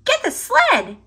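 high, squeaky mouse voice urging hurry; Squeak voice. Acted. Squeak to Pip, fretting they're late, in a high squeaky voice.

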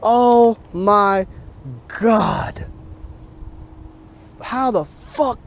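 high, stunned exclamations, drawn out slowly; can't believe the fish just broke off; stunned disbelief. Real, not acted. Angler to his viewers after the snap, stunned disbelief. Still reeling from lost fish.

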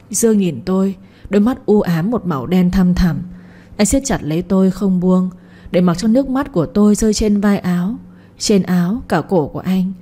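ordinary delivery

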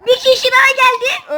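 excited shouting. Real, not acted. Player warning teammate of another attacker, excited high shout. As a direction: yell the update in the heat of the fight. Calling out a fight in game.

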